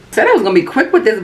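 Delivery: rushed, quick delivery; talking fast. Real, not acted. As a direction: hurry through it to save time. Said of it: "trying to talk real fast".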